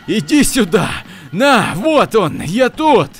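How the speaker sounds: high, loud, manic yell; frantic shouting. Acted. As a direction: scream wildly, daring it to come. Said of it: "кричал я как дебильный". (безумный крик)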